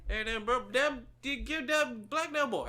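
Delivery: high, loud, slow country holler. Acted. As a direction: holler it in an over-the-top country accent. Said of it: "I'll fill in for you".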